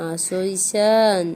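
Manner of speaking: slow, drawn-out tender call; gentle coaxing. Real, not acted. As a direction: stretch the words to lure him in. Coaxing crying child into lap.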